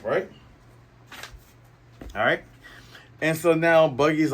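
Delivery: ordinary talk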